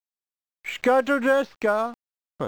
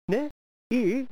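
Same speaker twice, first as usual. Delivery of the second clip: ordinary talk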